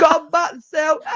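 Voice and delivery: very high, drawn-out plea; imitated calling out. Fan calling a player back to Celtic, drawn-out mock holler. Repeats the acted call, laughing.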